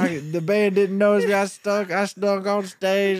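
put-on high rambling drunk excuse; hammed-up drunk dad. Drunk dad explains sneaking on stage, high and rambling. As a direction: slur through the bongo story.

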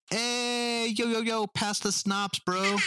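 high, laid-back party bro. Retold or imitated. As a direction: call out like a chill bro. Austrian soldiers demand schnapps.

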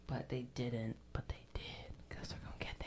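hushed, voiceless whisper; whispered aside. Real, not acted. Hinting at what comes later, whispering. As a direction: drop into a very soft whisper.

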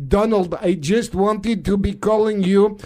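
mock Russian accent, chummy caller; Vladimir Putin. Russian leader phoning his friend Donald, mock Russian accent. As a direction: greet a comrade in a stagey Russian accent. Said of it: "I'm now talking in a Russian accent".

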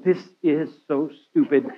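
soft, laughing grumble under the breath; muttered complaint. Retold or imitated. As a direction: mutter it to yourself, half laughing. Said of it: "I would be marching around going".

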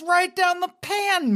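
high, slow mock British; mock British phrase. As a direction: quote it in a put-on high British lilt. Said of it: "what does mean".

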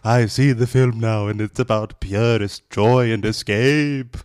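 stuffy British guy. Acted. Reader quoting the film blurb, stuffy British voice. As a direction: declaim it grandly in stuffy British. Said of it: "Stuffy British guy".

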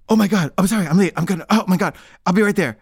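acted-out frantic phone excuse; snapped-out panic. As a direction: gasp and apologise in a fluster. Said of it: "suddenly snaps out of her trance".

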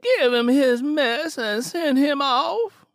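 put-on high, loud, slow old woman's order; Big Mama ordering them to send Simi off; Big Mama's voice.

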